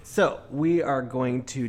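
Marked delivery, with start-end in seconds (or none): none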